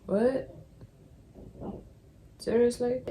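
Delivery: high, disbelieving What and Seriously; incredulous surprise. Retold or imitated. Hearing a Russian speaker will call, incredulous. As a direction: toss it up in disbelief. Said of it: "I was like".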